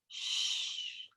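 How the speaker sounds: gentle unvoiced shh; a quiet shushing breath; soft shush. A narrator's reading. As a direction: hush a sleepy child. Hushing the truck to sleep.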